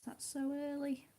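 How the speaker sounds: ordinary talk, nothing marked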